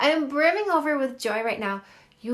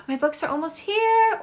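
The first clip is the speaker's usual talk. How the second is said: high, gasping excitement; gasp, then lift the whole line high; excited announcement; 4 semitones higher, 5 dB louder than usual. Real, not acted. Author telling live viewers her books are arriving, excited. Gasps at news of book delivery.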